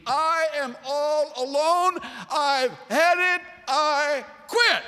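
high, loud, slow cry of giving up; despairing outburst. Acted. Moses telling God he quits, loud despairing cry. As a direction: wail it like a man at the end of his rope.

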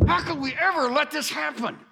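high-pitched panicky wail, acted out; panicked. Israelites caught in a trap like that, acted panic. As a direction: cry out in mock panic. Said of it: "They panicked".

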